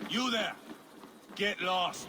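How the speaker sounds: loud, high bark of an order; shouting a stranger away from the table; shouting someone off. Acted. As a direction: yell at him to clear off. Ivar shouts a man away.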